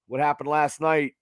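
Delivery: ordinary talk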